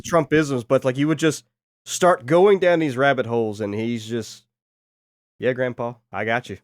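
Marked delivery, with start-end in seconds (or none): none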